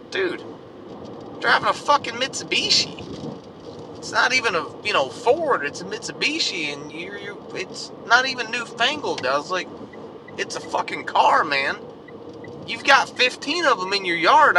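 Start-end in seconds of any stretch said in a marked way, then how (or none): 0.1-9.2 exasperated retort. Retold or imitated. Retold exasperated reply to rednecks. 10.4-14.6 higher, louder incredulous protest; exasperated retort. Retold or imitated. Retold exasperated reply continues.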